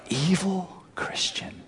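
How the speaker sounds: whispered, slow, hissed insult; hammed-up gasp of outrage, under the breath; mock scandalized whisper. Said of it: "She said".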